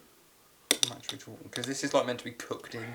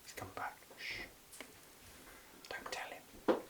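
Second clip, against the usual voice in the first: whispering; 11 dB quieter, slower than usual. Real, not acted. Secret aside to camera.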